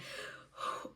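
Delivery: hard pushing breath; effortful exhale. Retold or imitated. Mother pushing without screaming, effortful exhale.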